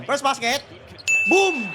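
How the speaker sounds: loud, high celebration; triumphant fan yell; excited shout. Real, not acted. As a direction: burst out cheering the basket. Celebrating the first basket.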